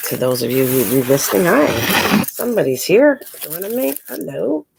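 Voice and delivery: ordinary delivery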